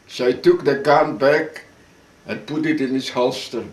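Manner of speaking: ordinary delivery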